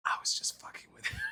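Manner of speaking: hushed, voiceless confiding whisper; whispered aside. Retold or imitated. Imitating an aside to a gym buddy, whispering. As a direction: whisper it so he won't overhear.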